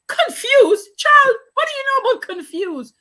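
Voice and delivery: high, loud outraged retort, laughing through it; mock indignation. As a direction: play up the mother's offense at her child. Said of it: "I was so mad".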